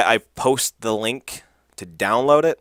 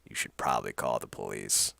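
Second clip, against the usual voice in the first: mildly menacing lowered hitman voice; cold; 11 semitones lower, 10 dB quieter than usual. Retold or imitated. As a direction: lower and softer, a cold flat threat. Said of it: "and so coldly goes".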